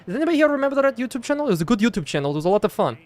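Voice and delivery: put-on Eastern European accent, pitched slightly up; Slovenian-Slovak accent. Shown as a demonstration. Streamer showing off the accent to chat, Slovenian accent. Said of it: "It's a little bit of Slovenian".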